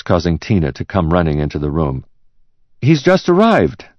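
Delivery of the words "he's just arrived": much higher-pitched secretary; secretary's voice. Acted. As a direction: report promptly in a high secretary voice. Secretary answers her shouting boss.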